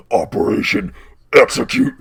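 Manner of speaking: announced command building to a high, loud cry; changed mech voice. Acted. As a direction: switch voice, then blast the last word. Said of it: "and his voice changes".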